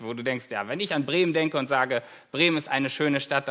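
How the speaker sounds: ordinary talk, nothing marked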